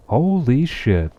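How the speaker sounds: real amazement at who just showed up; stunned. Real, not acted. Streamer sees a favorite viewer.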